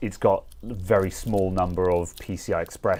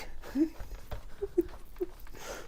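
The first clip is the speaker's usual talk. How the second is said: airy high giggle; high breathy laugh; 11 dB quieter than usual. Real, not acted. Laughs at own bad accent.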